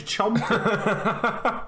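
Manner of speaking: laughing. Real, not acted. Teasing about chewing noises.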